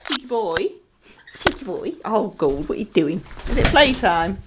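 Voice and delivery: normal talking voice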